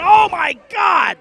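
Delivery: loud, slow stunned shout; excited shout. Real, not acted. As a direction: scream it as they both drop. Double knockout call.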